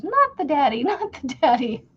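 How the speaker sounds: put-on lightly high, playful child's chant; kid's catchphrase. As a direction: mimic the son teasing. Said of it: "he goes".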